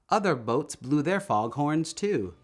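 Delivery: ordinary delivery, nothing marked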